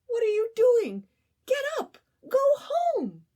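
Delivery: very high, loud frantic urging; alarmed Ant. Acted. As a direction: shout him awake in panic. Ant alarmed at Grasshopper in snow.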